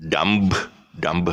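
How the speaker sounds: "dumb" as DUM-b; B sounded. Shown as a demonstration. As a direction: wrongly release the B in "dumb". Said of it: "if you pronounce the word".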